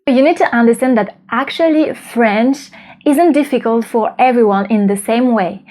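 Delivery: ordinary delivery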